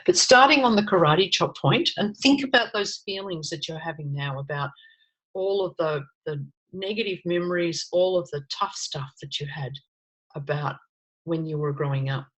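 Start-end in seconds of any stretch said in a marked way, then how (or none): 2.0-12.3 softer, gentle guiding into tough memories; soft guided voice. Acted. Coach guiding listeners softly through painful childhood memories. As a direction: lead them quietly into old hurts.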